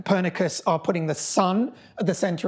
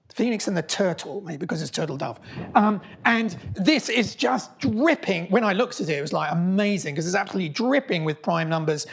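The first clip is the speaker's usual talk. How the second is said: ordinary delivery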